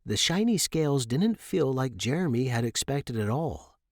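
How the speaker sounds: usual speaking voice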